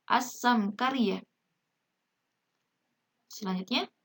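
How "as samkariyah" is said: slow "as-samkariyah" with a long S; silent lam. Shown as a demonstration. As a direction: read "as-samkariyah" deliberately, no L. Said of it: "lamnya jangan sampai terdengar ya".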